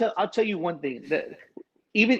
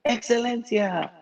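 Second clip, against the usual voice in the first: stage announcer; 6 semitones higher than usual. Retold or imitated. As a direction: introduce the band like an MC. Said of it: "she's like".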